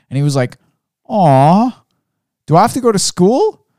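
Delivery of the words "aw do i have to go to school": put-on high, louder childish whine; whiny kid. Dad imitating son's disappointed whine, high and loud. Son whining about school.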